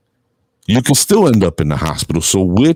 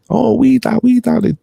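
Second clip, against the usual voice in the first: much higher mock kid voice; imitated kids; 14 semitones higher than usual. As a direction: squeak it like little kids.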